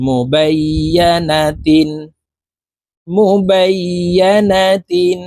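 drawn-out Quranic recitation, syllable by syllable; slow model recitation. Shown as a demonstration. Teacher reciting the assembled word for learners, slow chant. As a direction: stretch each syllable evenly, half-chanted.

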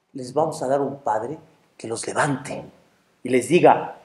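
low, soft answer; reply quietly, unmoved by the panic; calm angel. Acted. Angel promising the children another father, calm and low.

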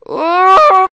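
high, very loud drawn-out cry; startled cry. Real, not acted. Sudden cry in the game. (cri aigu et prolongé)

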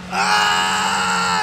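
long drawn-out yell; held "aah" of alarm; yelling through a skid; crash scream. Real, not acted. Crashing mid-race.

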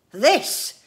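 loud, high burst of irritation; through clenched teeth. A narrator's reading. Inventor corrects his family, loud through clenched teeth. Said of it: "said ... through clenched teeth".

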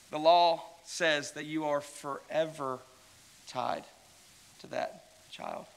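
slow, weighty, sinking to a hush on the last words; slow solemn emphasis. Real, not acted. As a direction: lay it down slowly and let it fade to a whisper. Preacher stresses forever bond.

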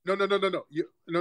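ordinary talk

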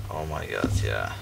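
normal talking voice

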